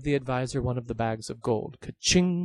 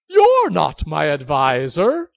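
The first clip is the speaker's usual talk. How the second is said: stunned; 4 semitones higher, 12 dB louder, slower than usual. Acted. King stunned by reveal.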